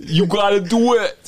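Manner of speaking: put-on high, loud German accent mom. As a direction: push him on like a German mom. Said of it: "That was an impression of his mom".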